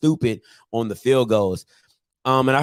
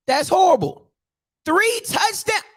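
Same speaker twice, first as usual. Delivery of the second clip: incredulous. Real, not acted. Exasperated over stats.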